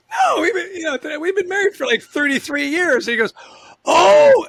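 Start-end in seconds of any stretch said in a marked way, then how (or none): 0.1-3.1 high, louder, indignant correction; laughing off the insult, high and quick; incredulous protest. Retold or imitated. Husband correcting Marshall, high and incredulous. Telling Marshall how long they've been married. 3.8-4.5 high, loud startled oh; a big surprised oh of realization. Retold or imitated. Marshall caught out, loud surprised oh.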